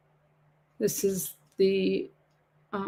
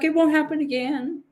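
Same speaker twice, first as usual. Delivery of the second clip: higher-pitched retold apology; a hint of a put-on higher voice; quoting the texter; 8 semitones higher than usual. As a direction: lightly imitate him promising, pitched up. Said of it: "He's like".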